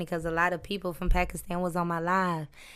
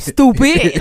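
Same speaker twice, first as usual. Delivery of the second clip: mock scold; 7 semitones higher, 11 dB louder than usual. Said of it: "I be forgetting, like".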